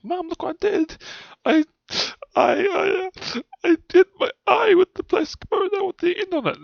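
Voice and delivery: put-on high, wailing child sobbing to mum; crying child. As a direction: sob it out like a hurt little kid.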